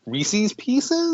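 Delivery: Pieces said "peeces", rising; Pieces with long E. Shown as a demonstration. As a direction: Pieces pronounced "pee-ses", long E. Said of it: "say Pieces correctly".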